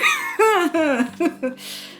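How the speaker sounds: high giggling laugh; real burst of laughter. Real, not acted. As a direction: laugh helplessly at yourself. Laughing at her diary.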